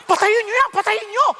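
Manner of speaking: hammed-up panic, shout to kill the TV; retold yell. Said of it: "Sabi ko".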